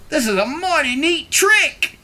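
high, impressed dog voice; admiring dog. A narrator's reading. Dog admiring Duck's riding, high impressed voice. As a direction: marvel at the trick in a high voice. Dog's admiring thought, acted.

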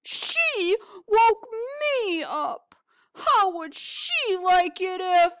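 loud, high, slow huffy complaint; grumpy bear. Acted. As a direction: bellow it like a cranky woken bear. Bear grumbling about being woken.

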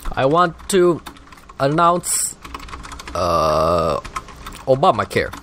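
mock a president giving a speech; Obama impression. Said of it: "that wasn't a good impression".

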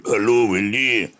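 reply to Willie as Tom; Tom's voice. Retold or imitated. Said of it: "And Tom".

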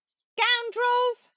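high, loud indignant shout; angry shout. A narrator's reading. Ratty shouts after the car in high loud outrage. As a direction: yell after the road hogs. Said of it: "shouted Ratty".

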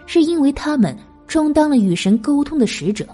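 ordinary delivery

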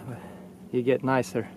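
normal talking voice

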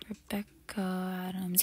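slow whispered name; whispered repeat. Acted. Doorman softly repeating the guest's name while checking.

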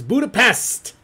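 Budapest punched loud and high; emphatic exclamation. Real, not acted. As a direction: belt out "Budapest" as the big reveal. Exclaims the correct answer.

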